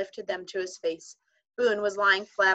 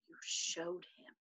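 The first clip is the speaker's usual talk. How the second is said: much softer, slow breathy whisper; trembling voice; 13 dB quieter, slower than usual. A narrator's reading. Omri to Patrick, a soft trembling whisper. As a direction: barely get the words out, shaking. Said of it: "he said at last in a trembling voice".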